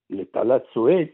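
ordinary talk, nothing marked

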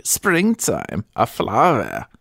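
put-on "springtime, a flower" in a thick German accent, high on "springtime"; German-accented praise, each image set apart; strong German accent. A narrator's reading. German attendant praising her mistress, strong German accent. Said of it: "with a strong German accent".

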